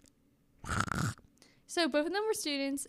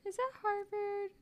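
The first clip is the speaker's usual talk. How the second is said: mocking imitation; 9 semitones higher, 5 dB quieter than usual. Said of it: "I said "Is it by Harvard?".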